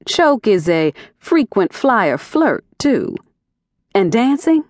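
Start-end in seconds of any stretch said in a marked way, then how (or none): none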